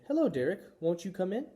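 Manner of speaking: high, careful welcoming voice; polite hostess. A narrator's reading. Mother practising a door greeting, high and polite.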